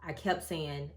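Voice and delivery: normal talking voice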